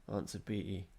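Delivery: plain reading voice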